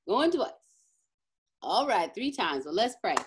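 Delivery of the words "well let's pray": ordinary talk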